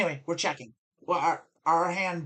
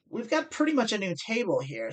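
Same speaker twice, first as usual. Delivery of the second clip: normal talking voice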